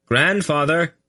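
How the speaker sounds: high call up the stairs. A narrator's reading. Boy calling up the stairway, high call. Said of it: "he called up the stairway".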